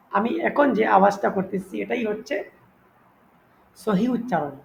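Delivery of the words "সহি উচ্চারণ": usual speaking voice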